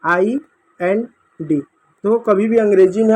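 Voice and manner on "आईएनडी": आईएनडी drawn out very slowly, falling at the end; teacher slowly spelling out आईएनडी; slow spelled-out demo. Shown as a demonstration. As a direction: stretch आईएनडी out slowly for the class to hear. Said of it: "अगला शब्द है आईएनडी का".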